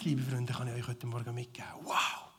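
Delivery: soft, intimate sermon voice; hushed preaching. Real, not acted. Pastor sharing quietly with friends, soft and close. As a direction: lean in and speak low to the congregation.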